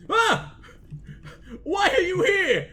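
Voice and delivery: high, loud startled outburst; yelp, then loudly demand an answer; shocked high-pitched shout; startled yell. Pretend. Startled by character turning up.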